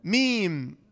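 usual speaking voice

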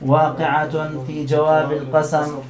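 slow, measured grammar recitation; parsing recitation. Shown as a demonstration. Teacher models the parsing for students, slow recitation. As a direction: recite the parsing deliberately, word by word.

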